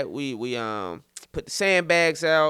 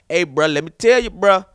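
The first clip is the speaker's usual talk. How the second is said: lightly voiced insistent friend, louder; homeboy on the phone; 2 semitones higher, 8 dB louder than usual. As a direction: imitate a buddy butting in with advice. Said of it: "His homeboy was like".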